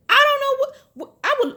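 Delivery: high, sputtering, lost for words; sputtering disbelief. Real, not acted. Vlogger sputters at the wife, lost for words. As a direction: splutter as if speechless.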